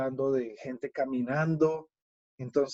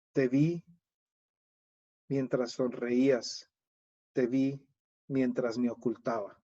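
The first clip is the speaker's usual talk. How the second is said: anaphora recital; 2 semitones lower than usual. Shown as a demonstration. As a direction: let each te vi hang before the next. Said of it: "eso acabo de hacerlo yo aquí".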